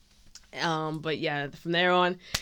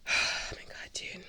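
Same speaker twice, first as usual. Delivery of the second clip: breathless whispered awe; overwhelmed whisper; 6 dB quieter than usual. Retold or imitated. Fan overwhelmed after seeing her idol, stunned whisper.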